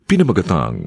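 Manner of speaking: normal talking voice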